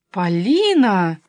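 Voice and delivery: loud, drawn-out scolding call; indignant. A narrator's reading. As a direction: call her out, outraged, stretching the name. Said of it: "возмутилась я". (возмущённый окрик)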